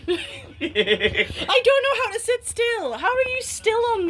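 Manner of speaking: laughing. Real, not acted. Laughing about swiveling chair.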